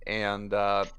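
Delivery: normal talking voice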